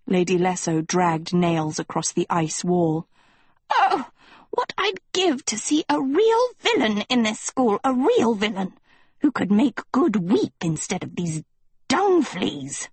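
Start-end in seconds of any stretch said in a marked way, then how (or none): 3.7-12.9 high theatrical villainess sigh; wicked longing. A narrator's reading. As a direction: savor every cruel word. Lady Lesso longing for villains.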